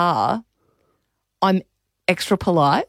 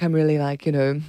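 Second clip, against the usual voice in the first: normal talking voice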